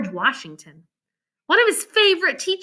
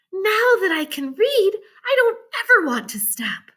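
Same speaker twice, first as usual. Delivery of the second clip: put-on higher eager girl's voice; Jane; 7 semitones higher than usual. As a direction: declare it with new joy. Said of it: "she said".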